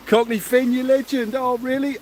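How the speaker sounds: high, excited shout-out; delighted surprise. Real, not acted. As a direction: hail a supporter with real excitement. Delighted at a viewer's donation.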